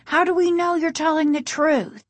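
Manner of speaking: high, doubting challenge; insistent. A narrator's reading. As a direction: challenge someone you distrust. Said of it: "Casey insisted".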